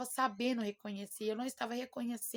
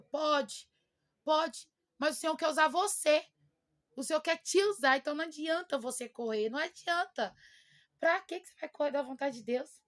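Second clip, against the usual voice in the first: higher, louder, pressing the point to the church; fervent preaching; 5 semitones higher, 6 dB louder than usual. Real, not acted. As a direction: preach it out with full conviction. Prega com força à igreja. (pregação fervorosa, mais alta e forte)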